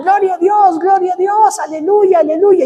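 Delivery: retold glad shout of the faithful; crowd shouting praise. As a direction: call out praise like a joyful congregation. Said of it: "toda la gente en la iglesia empezó a gritar".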